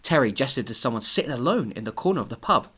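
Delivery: normal talking voice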